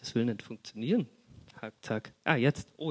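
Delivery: usual speaking voice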